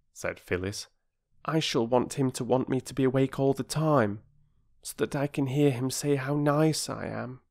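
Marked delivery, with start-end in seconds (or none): 1.4-7.4 put-on high, dreamy little-girl voice; imagine being adored, as a child; self-satisfied girl. Voices Phyllis in the story.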